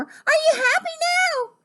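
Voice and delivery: very high, eager frog voice; high-pitched call. Acted. Frog asking the captain through the door, calling out high.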